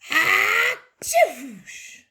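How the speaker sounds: high, loud "ah-toosh"; mock sneeze noise. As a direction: blast out a big pretend sneeze. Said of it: "Cow went up to Dog and went".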